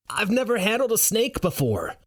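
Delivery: high-pitched panicky call back; frozen with fear, shouting back; scared high-pitched reply; fearful yell. Acted. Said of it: "I yell back, frozen in fear".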